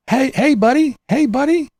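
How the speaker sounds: high, taunting "hey buddy"; calling out to him, sarcastic. Real, not acted. Calling out the rival vlogger, high mocking taunt.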